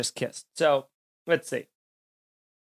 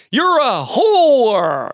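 Andrew Dice Clay; 6 semitones higher, 5 dB louder, much slower than usual. Retold or imitated. Host mocking a comic's crude yell, slow and loud. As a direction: hammer it out like a brash stand-up. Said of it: "what am I, Andrew Dice Clay?".

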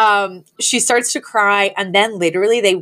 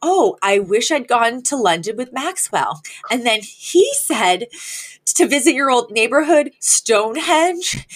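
lifted high, a fussy socialite's retelling; a socialite rehashing a spat, high and theatrical; high dramatic Cece; 4 semitones higher than usual. Retold or imitated. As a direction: play Cece recounting the insult, pitched up. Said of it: "I had just said".

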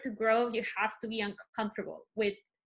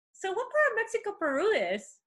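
imitating classmate; 9 semitones higher than usual. Classmate's ignorant question voiced.

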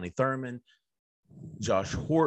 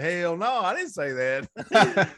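higher, slow mock protest; mock indignant denial; 6 semitones higher, 4 dB louder, slower than usual. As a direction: drag out a fake indignant no. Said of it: "I was like".

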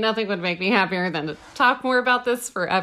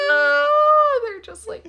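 high, teary squeal of delight; titillated; 13 semitones higher, 4 dB louder than usual. Real, not acted. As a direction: melt with joy, almost crying. Touched by dolphin skincare.

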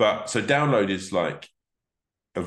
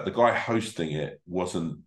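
normal talking voice

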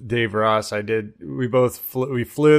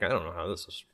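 sheepish murmur; 8 semitones lower, 15 dB quieter, much faster than usual. Retold or imitated. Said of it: "you're like".

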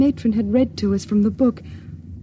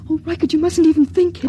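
frightened plea; 5 semitones higher, 4 dB louder, faster than usual. Acted. Frightened by heresy.

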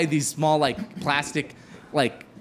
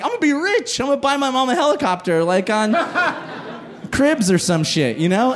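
giddy excitement; 6 semitones higher than usual. Retold or imitated. Poor comedian dreaming of money.

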